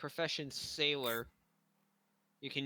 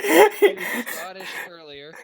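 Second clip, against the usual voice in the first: laughing; 5 semitones higher, 18 dB louder than usual. Real, not acted. Joking about the accents.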